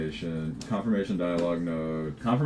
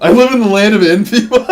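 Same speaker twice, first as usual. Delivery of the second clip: loud, high outburst breaking into laughter; uitroep; 16 dB louder, faster than usual. Real, not acted. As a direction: blurt it out high and loud, cracking up. Laughing outburst mid story.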